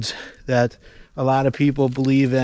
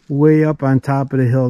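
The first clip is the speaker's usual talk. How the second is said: ordinary talk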